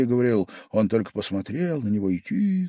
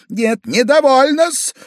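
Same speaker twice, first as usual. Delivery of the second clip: high, slow, loud retort; Pavel Pavlovich, defiant; 8 dB louder, slower than usual. A narrator's reading. Drunk guest leaping up to object, loud and defiant. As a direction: jump in with a high-pitched refusal. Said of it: "вскочил и Павел Павлович".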